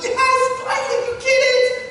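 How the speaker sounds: high, loud, elated exclamation; thrilled, smiling shout; delighted Jesus. Acted. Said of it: "his eyes light up, and he's got this big smile".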